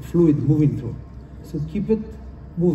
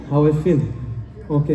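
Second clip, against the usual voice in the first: ordinary talk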